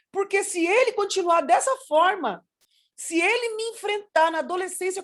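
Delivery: building, high-pitched plea; pleading prayer. Retold or imitated. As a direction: re-enact a mother begging God for help. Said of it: "aí eu orava".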